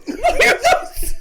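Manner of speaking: high squeal of mock outrage; mock-outraged yelp; shrill disbelief. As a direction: squeak it out, laughing. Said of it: "And he's like".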